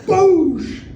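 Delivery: loud, high 'boosh' burst; explosive slam noise. Retold or imitated. Ending the phone fight with lawyer, explosive boosh. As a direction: blast out a slamming sound effect.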